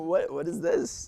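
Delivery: soft, slow, voiceless mock disbelief; retold disbelief. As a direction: mouth it slowly in mock shock. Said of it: "I was like".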